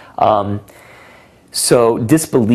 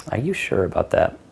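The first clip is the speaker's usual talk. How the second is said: mock disbelief; 4 dB quieter than usual. Shown as a demonstration. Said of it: "So it's funny".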